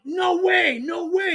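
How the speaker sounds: high-pitched disbelieving protest; retold losing it. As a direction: reenact a freak-out, pitch shooting right up. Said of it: "he sees me losing it".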